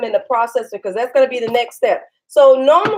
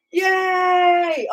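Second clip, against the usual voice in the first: high, long-held cheer; excited cheer; 7 semitones higher than usual. Real, not acted. Mother cheering with her excited daughter, excited. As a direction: draw it out in a high happy call.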